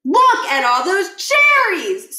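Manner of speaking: drawn-out awed look; slow amazed Stuart. Acted. Stuart amazed at the cherries, slow and high. Reread of the same line.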